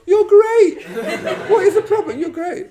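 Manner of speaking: laughing, gushing you're great at her; giddy delight. Retold or imitated. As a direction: burst out with overjoyed relief. Delighted realisation in retold story.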